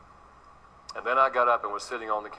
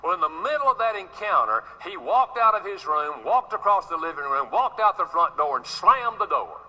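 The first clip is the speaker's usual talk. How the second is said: raised, driving preacher's delivery, pitch lifted high; preaching at full force; 7 semitones higher than usual. Acted. Preacher telling his congregation about a fight with his son, raised. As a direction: build the story up like a revival sermon.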